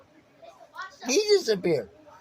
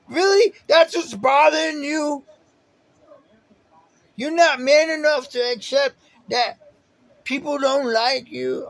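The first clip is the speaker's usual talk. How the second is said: higher, louder sneering taunt; mocking taunt; 7 semitones higher, 4 dB louder than usual. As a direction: needle him like a contemptuous elder. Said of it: "that's how he acted".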